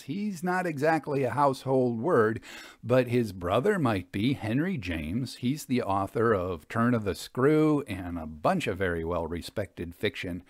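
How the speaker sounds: plain reading voice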